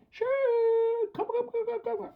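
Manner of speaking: very high squeaky "come, come, come"; Minnie Mouse squeaky voice. Shown as a demonstration. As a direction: squeak it to build the dog's drive. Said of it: "something out of a Minnie Mouse".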